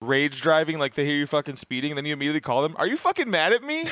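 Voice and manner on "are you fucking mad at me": feigned surprise. Retold or imitated. Calling right after rage driving.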